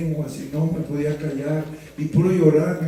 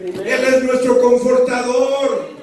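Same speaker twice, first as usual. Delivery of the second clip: full-force preaching; 4 semitones higher than usual. Acted. Pastor urging the congregation, full preaching voice. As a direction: preach it out to the whole room.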